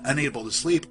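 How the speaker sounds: plain reading voice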